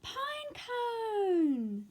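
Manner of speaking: high, loud, long drawn call. Acted. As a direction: call across the forest. Calling out for friend.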